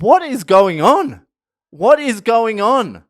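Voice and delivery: disbelief. Retold or imitated. Narrator to himself watching the Aussies, loud disbelief. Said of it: "I'm like".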